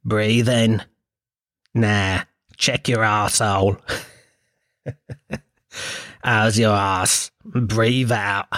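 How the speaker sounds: low, slow mock meditation guide; annoying meditation voice. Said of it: "a fella going".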